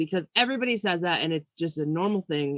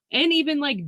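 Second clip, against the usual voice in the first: laughing; 10 semitones higher, 5 dB louder than usual. Real, not acted. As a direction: still laughing. Laughing after "I hate that.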